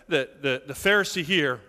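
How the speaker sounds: usual speaking voice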